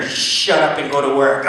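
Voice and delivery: low, slow stern command; stern self-talk. Retold or imitated. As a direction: order yourself to get going. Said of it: "myself tells the mirror".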